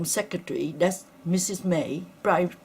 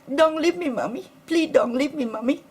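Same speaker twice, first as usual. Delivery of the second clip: put-on child screaming; 7 semitones higher than usual. Mother recalls her son crying as the ambulance leaves, higher. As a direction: plead like a frightened little boy, easing off. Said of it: "I heard my baby screaming".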